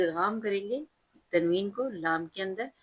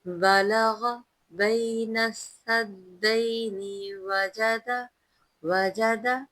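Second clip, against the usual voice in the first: slow chanted verse with long vowels; tajweed recitation; 3 semitones higher, 5 dB louder, much slower than usual. Shown as a demonstration. Teacher reciting the verse for students, slow melodic chant. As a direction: chant each word slowly and melodiously.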